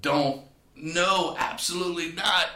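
high, loud inner protest; mock scream of refusal inside. Telling Richard no in his head, a loud high mock scream. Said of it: "My body was screaming".

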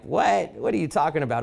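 high incredulous what; mock surprise. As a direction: play the students feigning shock. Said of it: "people would be like".

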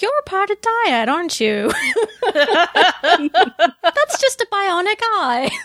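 hammed-up British accent, breaking into laughs; bad British accent. Felix quizzing Bo about Dyad, in a bad British accent. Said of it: "That's my terrible British accent".

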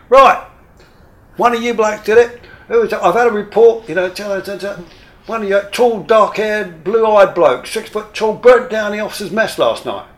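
high, loud barking commander; officer bellowing an accusation at his men; angry officer. Retold or imitated. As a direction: tear into the crew like a furious station commander. Station commander berating the crew.

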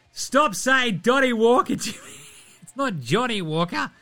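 high-pitched mock scolding of yourself; exasperated at your own slip, played up; mock exasperation.

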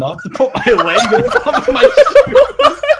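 laughing through the words. Real, not acted. Explaining the shoe stacking to friends, laughing hard.

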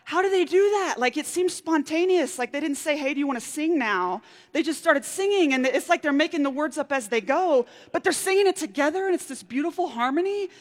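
put-on high, a touch of a wide-eyed kid; lightly voiced child marvelling; childlike wonder. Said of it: "I thought".

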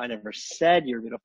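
"said" leaned on, rest plain; stress on said. Shown as a demonstration. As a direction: make said the key word. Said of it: "just put the emphasis on a different word each time".

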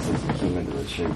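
ordinary talk

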